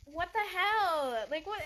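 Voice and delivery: outraged. Real, not acted. Outraged at a husband who won't clean, slow loud cry. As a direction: let it burst out in disbelief.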